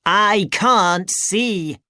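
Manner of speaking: high, slow little robot. Acted. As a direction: speak slowly like a small robot. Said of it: "said Clunk".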